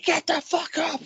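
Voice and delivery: high, strained mock yell; retold screaming. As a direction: yell a fed-up order, re-enacted. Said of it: "I'm screaming at him".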